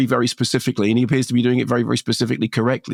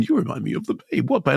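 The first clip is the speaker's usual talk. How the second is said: movie star impression; 3 dB quieter, faster than usual. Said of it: "that's the impression that I kept doing".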